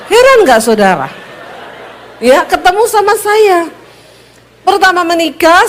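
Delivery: normal talking voice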